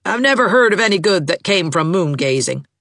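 lightly voiced disapproving matriarch; a touch of a crabby old woman calling; old mother calling out. A narrator's reading. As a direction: call your disapproval from the next room. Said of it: "called out Missus Gibson from the sitting room".

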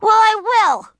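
put-on high, loud defiant child; loud high-pitched stubborn retort. A narrator's reading. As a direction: dig in against father. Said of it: "Ramona was defiant".

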